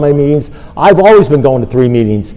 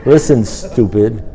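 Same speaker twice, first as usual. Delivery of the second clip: acted sponsor spelling it out slowly; measured, unhurried verdict; slow, deliberate pronouncement; 3 semitones lower, much slower than usual. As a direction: spell it out with long pauses between phrases. Acts sponsor delivering memorable line.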